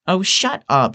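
high-pitched, exasperated woman's voice; annoyed. A narrator's reading. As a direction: brush him off, annoyed. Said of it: "she says, annoyed".

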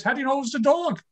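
a lightly voiced wife, teasing; wife needling him about the kicking in bed, mildly mocking; wife's jab. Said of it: "My wife says".

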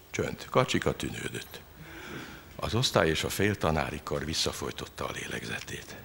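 ordinary delivery, nothing marked

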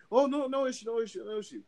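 the worker scrambling to back off; mock nervous worker. Mimics worker backing down again.

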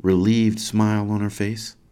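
ordinary delivery, nothing marked

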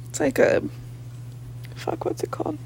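muttered whisper to herself. Real, not acted. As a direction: whisper it, searching for the word. Searching for a word.

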